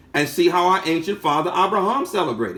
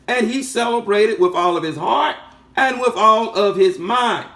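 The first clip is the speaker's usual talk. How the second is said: higher, pressing preacher's build; raised preaching voice, slowing on key words; fervent preaching; 4 semitones higher than usual. Acted. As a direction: lift them with the promise. Sermon climax, raised preaching.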